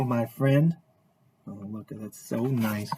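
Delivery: plain reading voice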